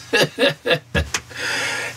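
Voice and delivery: long, low, soft chuckle. Acted. Would-be consultant pleased with his idea, low chuckle. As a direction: chuckle softly at your own scheme.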